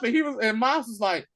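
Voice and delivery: ordinary talk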